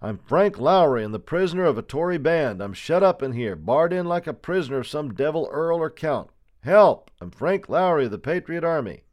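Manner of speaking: high-pitched urgent calling out; shouted appeal. A narrator's reading. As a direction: call for help through a closed shutter. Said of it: "the silence that followed his appeal".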